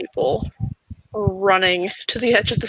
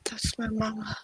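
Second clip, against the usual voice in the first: hushed, barely voiced whisper; 12 dB quieter than usual. Son seeing his mother answers Sebastian, whispering. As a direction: whisper it, very small and quiet.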